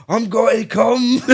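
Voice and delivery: high, drawn-out shout. Retold or imitated. Imitating a man shouting on a video, drawn-out mock shout. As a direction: yell it out slowly, half laughing. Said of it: "just shouting".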